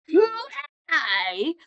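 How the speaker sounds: loud mock-outraged repeat; indignant outburst. Villain nobody remembers repeats his question, loudly indignant. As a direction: imitate an affronted villain, loudly.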